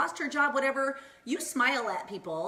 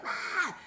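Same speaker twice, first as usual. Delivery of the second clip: a short high yelp of excitement; freaked-out yelp; 8 semitones higher than usual. Retold or imitated. Telling her mother-in-law about keto, a sudden high excited yelp. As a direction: squeal it like you can't believe it. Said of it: "I was, like, so freaked out".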